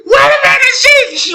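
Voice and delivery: high, loud, near-crying shout of disbelief; yelling in stunned disbelief at the clip; shocked outcry. Real, not acted. Vlogger reacting to the clip, high disbelieving shout.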